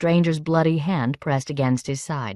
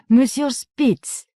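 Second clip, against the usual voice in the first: high, slow, drawn-out welcome; bright greeting; 9 semitones higher, 4 dB louder, much slower than usual. A narrator's reading. Baroness greeting Monsieur Spitz, high and bright. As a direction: sing out his name in delight. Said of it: "her voice bright and hysterical".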